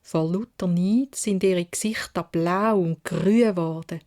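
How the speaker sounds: ordinary delivery, nothing marked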